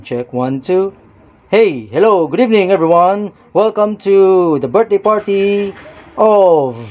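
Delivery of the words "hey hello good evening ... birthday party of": party MC. Pretend. Pretend MC welcoming guests to a birthday party, hosting voice. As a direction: greet the crowd like a party host, stretching the last words. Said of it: "kunwari, ganun yung M C".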